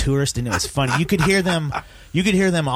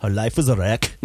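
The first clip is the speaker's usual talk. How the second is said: a lightly done Arnold voice, slightly lower; mock Arnold, a touch of Austrian; Arnold impression; 4 semitones lower than usual. Friend redoes the joke in a light Arnold voice. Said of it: "it was like an Arnold".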